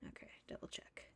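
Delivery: soft whisper to yourself. Real, not acted. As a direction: check it under your breath. Whispering to herself mid-game.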